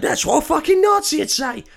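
high, strained mock rant; hammed-up paranoid grump; high put-on furious voice; mock angry painter. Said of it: "he's just like".

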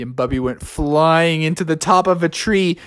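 ordinary delivery

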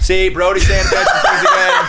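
laughing through the words; teasing laugh in the voice. Real, not acted. Teasing friend while laughing.

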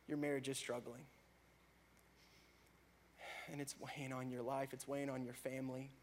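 normal talking voice

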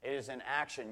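usual speaking voice

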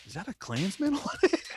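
high, incredulous mock whimper of a question; imitated stunned question. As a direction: ask it high, as your stunned friend. Friend's stunned question, retold high.

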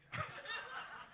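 a short, high laugh; breathy laugh. Real, not acted. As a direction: laugh at your own joke. Laughs at own joke.

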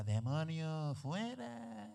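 put-on old woman rebuking. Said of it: "Y oigo a la vieja".

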